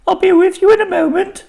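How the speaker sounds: high, loud call from afar. A narrator's reading. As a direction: sing it out from another room. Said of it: "shout from her office".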